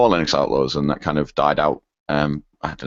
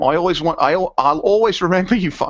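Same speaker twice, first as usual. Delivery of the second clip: higher-pitched attempt at an Australian accent; Aussie accent; 12 semitones higher, 3 dB louder than usual. Podcast host reading a listener's farewell email, in an Aussie accent. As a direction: put on a light Aussie voice. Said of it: "read this in an Aussie accent".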